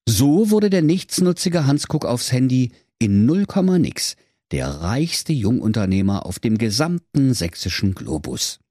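ordinary delivery

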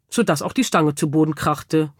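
ordinary delivery

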